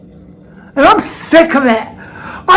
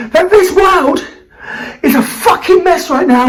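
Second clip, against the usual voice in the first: ordinary talk, nothing marked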